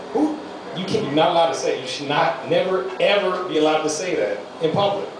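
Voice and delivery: ordinary talk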